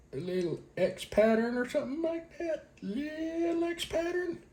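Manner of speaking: very high, soft little squeak; tiny high voice. Pretend. Vlogger squeezing out thermal paste, tiny high voice.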